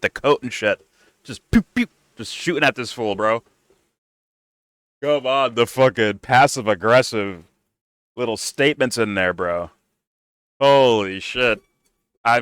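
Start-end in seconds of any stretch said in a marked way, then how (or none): none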